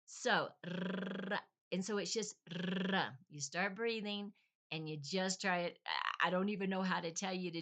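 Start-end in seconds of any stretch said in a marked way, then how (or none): 0.7-1.4 r trilled long on the tongue tip; rolled R. Shown as a demonstration. Showing learners what to practice, r as a long trill. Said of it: "getting those R's rolled". 2.9-3.0 r as a sustained trill; rolled R. Shown as a demonstration. Repeating the model for learners, r as a rolled trill. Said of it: "getting those R's rolled".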